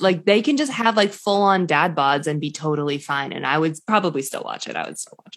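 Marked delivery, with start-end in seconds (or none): 4.8-5.4 much softer, voiceless aside; whispered aside. Real, not acted. Admitting she'd watch a dad-bod cooking show, whispered aside. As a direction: drop to a whisper, half to herself. Repeats the line under her breath.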